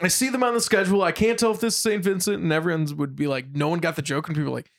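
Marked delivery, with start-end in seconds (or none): 0.0-2.4 high, hurried mock-puzzled insistence; playing confused. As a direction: imitate a prankster feigning confusion, pitched up. Retelling friend's mock-confused prank.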